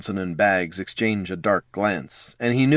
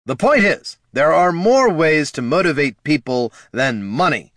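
snapping; 7 semitones higher, 6 dB louder than usual. Acted. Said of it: "snapped the king".

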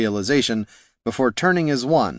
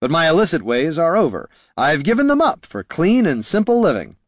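raised, emphatic declaration; lofty protest; 6 semitones higher, 3 dB louder than usual. A narrator's reading. Bard insisting his thieving days are over, lofty protest. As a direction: proclaim your reformed life with conviction.

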